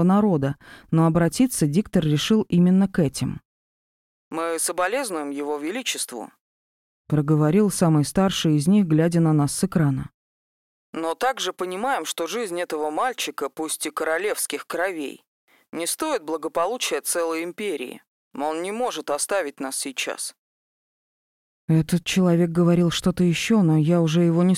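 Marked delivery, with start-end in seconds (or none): 4.3-6.3 merchant on TV. Acted. Merchant speaking on TV screen. 10.9-20.3 merchant on TV. Acted. Merchant speaking on TV screen.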